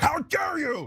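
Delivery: high, pearl-clutching gasp of offence; mock outrage. Host mocking The View panelists, high mock outrage. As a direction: play the scandalised panelist, way up high. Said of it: "they were like".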